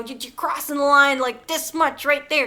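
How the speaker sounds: ordinary talk, nothing marked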